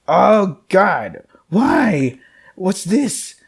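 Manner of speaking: sudden high, loud horrified gasp of words; high startled yelps of disbelief; shocked outcry. Real, not acted. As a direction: recoil in real shock. Shocked at pile of bones.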